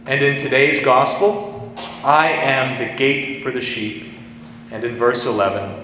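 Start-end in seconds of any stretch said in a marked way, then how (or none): 2.0-4.1 slow, weighty stress on "I am", each title spaced out; solemn, drawn-out proclamation of "I am"; I AM in all caps. Retold or imitated. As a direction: lean hard on "I am" and take it very slowly. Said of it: "in all caps".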